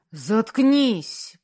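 loud, angry growl; growled order. A narrator's reading. Husband silencing his raging wife, growled. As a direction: growl it out as a furious command. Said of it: "прорычал он".

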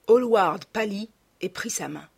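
plain reading voice

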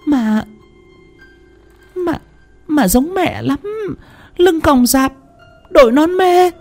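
terrified wife insisting she saw the ghost; desperate pleading. Acted. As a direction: plead to be believed, frantic with fear. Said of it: "Thanh phân trần".

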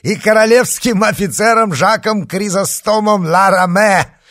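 high, loud mocking announcement; loud triumphant call. A narrator's reading. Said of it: "хохоча ещё громче".

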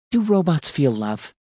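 high, timid question; young woman's voice. A narrator's reading. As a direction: ask it high and unsure.

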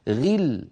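غل light, without strain; natural ghi. Shown as a demonstration. A teacher shows the correct unforced غل. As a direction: say غل simply, no added weight. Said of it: "من غير تكلف".

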